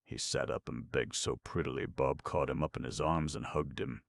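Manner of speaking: ordinary delivery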